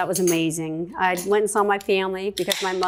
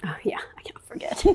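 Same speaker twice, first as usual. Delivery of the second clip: whisper; 5 semitones lower, 9 dB quieter than usual. Real, not acted. Aside while eating.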